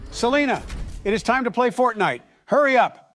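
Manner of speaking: high, loud calling out; shouting. Acted. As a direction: yell for her to hurry up. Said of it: "Don't shout at [name]".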